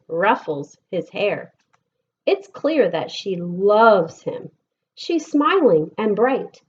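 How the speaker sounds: lilting, sing-song picture book reading; gentle storytime voice with bouncing rhythm; reading to children. Acted. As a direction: read the rhymes aloud to a class of kids. Reads picture book to class.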